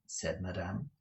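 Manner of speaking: plain reading voice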